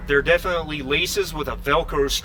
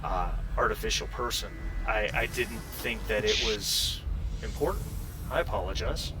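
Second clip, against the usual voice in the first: soft, lower, halting synthetic person; apologetic android; 5 semitones lower, 8 dB quieter than usual. Acted. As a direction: correct them gently, then apologize quietly. [name] answers an accusation.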